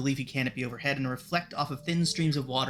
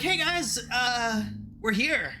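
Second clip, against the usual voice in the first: satyr voice; 10 semitones higher, slower than usual. Acted. Satyr voiced by game master.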